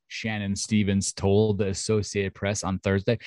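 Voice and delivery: normal talking voice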